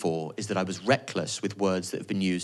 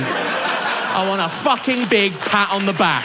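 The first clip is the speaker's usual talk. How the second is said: higher and louder, slow and insistent; mock demanding shout; 10 semitones higher, 5 dB louder, slower than usual. Real, not acted. To the audience, demanding praise in a slow shout. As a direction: demand the pat on the back.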